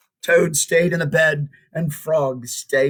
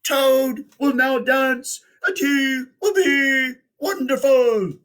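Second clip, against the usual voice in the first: strange announcer voice; 11 semitones higher than usual. Acted. A strange voice presents Toad to the theater, grandly announcing. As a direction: boom it out like a circus ringmaster. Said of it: "said the voice".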